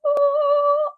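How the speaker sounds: high, loud, held "ahh"; heavenly "ahh" effect. Shown as a demonstration. Vlogger imitating a light-beam reveal, high sustained "ahh". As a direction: angelic reveal chime done by voice. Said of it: "just imagine".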